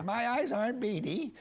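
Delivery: high-pitched mock protest; unhappy Regis Philbin. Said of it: "he'd get very unhappy".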